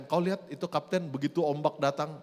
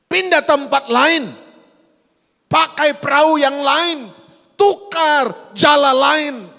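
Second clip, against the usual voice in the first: full-force preaching; 8 semitones higher, 11 dB louder than usual. Acted. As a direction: thunder it out over the congregation.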